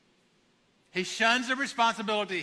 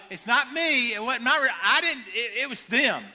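defensive excuse; 4 semitones higher, 4 dB louder than usual. Retold or imitated. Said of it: "He's saying".

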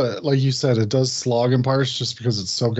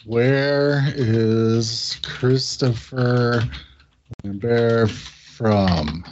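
reading the search aloud; 3 semitones lower, much slower than usual. Real, not acted. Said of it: "let's Google".

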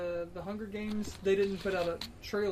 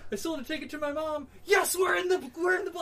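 mock triumphant shout; 11 semitones higher than usual. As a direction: cheer like you broke even. Mock filmmaker celebrating profits.

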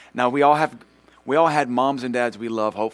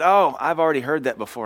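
loud, higher, dismissive mimicry; mock know-it-all; 5 semitones higher, 6 dB louder than usual. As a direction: put on a been-there attitude, loudly. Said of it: "that kind of attitude".

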